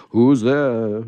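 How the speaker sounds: loud call into the darkness; shouted call. Acted. Santa calling out from his sleigh, loud shout. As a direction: bellow out into the night. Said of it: "he shouted out of the darkness".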